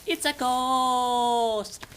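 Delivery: high, loud, long-drawn mock ghostly cry; spooky wail. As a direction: wail it slowly for spooky effect. Spooky suggestion, acted.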